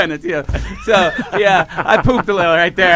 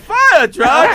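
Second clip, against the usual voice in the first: high, loud, delighted; thrilled at the thought of a fire truck; mock excited passenger; excited question; 10 semitones higher, 5 dB louder than usual. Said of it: "funniest thing I ever heard".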